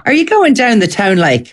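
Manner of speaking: practised copy of Irish Midlands lilt; imitated Ballinasloe accent. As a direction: try the Ballinasloe accent, dropping on "like". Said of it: "What do you think of my accent".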